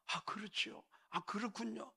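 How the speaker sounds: soft, agreeable murmur of acknowledgement; attentive listener. Acted. Preacher acting someone who listens well to others, softly agreeable. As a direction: nod along softly, as a good listener would. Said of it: "남의 말 잘 듣는 사람".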